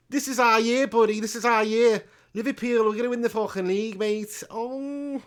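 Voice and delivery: high, loud Liverpool fan parody; hammed-up Scouse chanting boast; Scouse accent. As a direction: crow it like an over-excited Scouser. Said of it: "Say we're gonna win the league".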